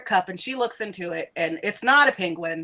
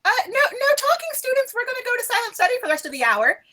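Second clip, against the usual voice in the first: much higher, fast chirpy teacher voice; airhead professor; faster than usual. Acted. Professor ordering silent study to end the talk, high fast voice. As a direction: hurry them into silence, bright and high. Professor shutting down class chatter.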